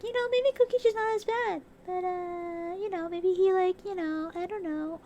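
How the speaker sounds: high, whiny voice of her hopeful past self; imitating her own naive hope, high and whiny; mock naive self. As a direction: play your old gullible self, pleading. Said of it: "I was like".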